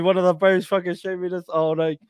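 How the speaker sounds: ordinary talk, nothing marked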